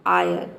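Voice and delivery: आयर said as one glide 'aa-yar', r half-sounded; ire sound. Shown as a demonstration. Teacher shows students the ire ending, gliding 'aa-yar'. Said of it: "इन तीनों को हो जाएगा".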